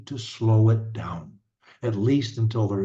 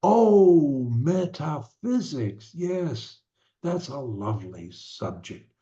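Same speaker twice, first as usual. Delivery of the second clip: high drawn-out "Oh", then slow, careful "metaphysics"; modelling it properly; 4 semitones higher, slower than usual. Acted. Coach plays a parent casually modelling the word, slow approving tone. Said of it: "use the word yourself properly".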